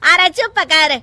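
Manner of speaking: very loud, furious mother-in-law; angry scolding. Acted. Mother-in-law scolding new mother over wasted money, angry. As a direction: shout her down, aggrieved.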